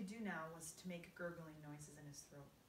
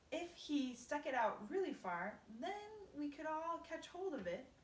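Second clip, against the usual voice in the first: higher, louder eager earthworm; 7 semitones higher, 3 dB louder than usual. Acted. Earthworm eagerly proposes pulling the centipede's tongue. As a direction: pitch the idea with growing enthusiasm.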